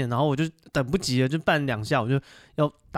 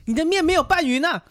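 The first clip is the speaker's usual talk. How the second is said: much higher, louder shop-auntie scolding; hammed-up loud auntie scolding; imitating the scolding owner; 5 dB louder than usual. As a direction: yell it at the customer from the kitchen. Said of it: "她说".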